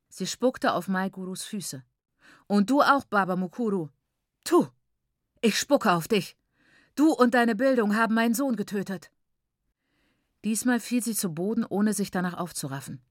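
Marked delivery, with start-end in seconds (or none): 2.5-9.1 high, raised grief-stricken accusing; furious accusation. Acted. Mother accuses the aunt of bewitching her son, high and furious. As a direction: hurl the blame at her.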